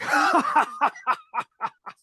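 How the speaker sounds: long, high-pitched mock guffaw; imitated boss laugh. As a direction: laugh like a boss tickled by his own idea. Imitating the boss amused by himself.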